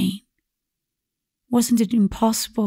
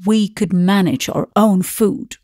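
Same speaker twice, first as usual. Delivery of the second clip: ordinary delivery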